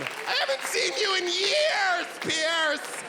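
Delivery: high, hammed-up greeting; mock shout. Shower example of the Pierce shout, high and playful. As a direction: yell to an old friend for fun. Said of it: "make up your own".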